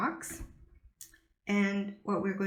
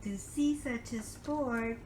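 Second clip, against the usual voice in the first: put-on reading to a toddler; 4 dB quieter, slower than usual.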